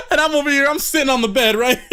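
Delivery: ordinary delivery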